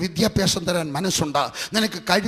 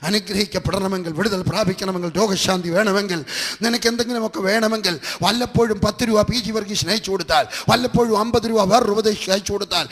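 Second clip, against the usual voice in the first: fiery preaching; 3 semitones higher, 5 dB louder than usual. Acted. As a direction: drive each condition home to the congregation. Preaching crescendo to crowd.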